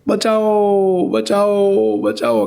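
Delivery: long drawn-out mock cries for help; retold cries for help. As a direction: stretch each plea out slowly, like calling for rescue. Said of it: "આણે બૂમો પાડવા માંડી".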